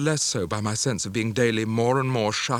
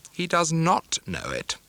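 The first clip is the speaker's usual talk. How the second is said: slow, cold and severe, higher; ominous spinster; 6 semitones higher, slower than usual. Acted. Sister-in-law interrupting over the lesson, slow and severe. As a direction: pronounce the verdict with dread weight. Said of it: "Miss Murdstone interposes awfully".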